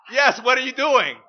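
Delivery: the parent insists, loud and sharp; insistent parent. Retold or imitated. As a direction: press hard, raise the voice. Parent presses the question.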